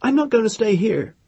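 put-on high, loud, defiant girl; proud defiance. A narrator's reading. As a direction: toss it back with proud defiance. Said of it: "she said, defiant".

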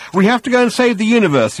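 high, loud hurried excuse; calling out. A narrator's reading. Ford excusing himself to the partygoers, calling out. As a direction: call it out on the way off.

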